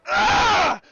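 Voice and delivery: loud, high battle yell; guttural scream. Acted. Player heaving the giant sword down, full-throated effort scream. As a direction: scream with all your might on the swing. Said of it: "you let out this guttural scream".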